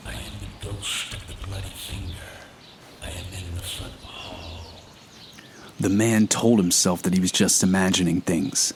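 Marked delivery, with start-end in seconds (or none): 0.1-4.7 soft, hollow ghost moaning; ghostly moan. A narrator's reading. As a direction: moan it like a ghost far downstairs. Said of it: "someone moaning these words".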